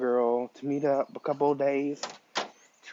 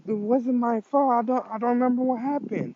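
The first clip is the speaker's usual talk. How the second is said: flustered pleading; 10 semitones higher, 5 dB louder than usual. Acted. Izuku pleads, blamed for kiss.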